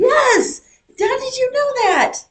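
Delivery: very high, delighted exclamation; real amazement. Real, not acted. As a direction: cry out high, truly astonished. Amazed at a correct answer.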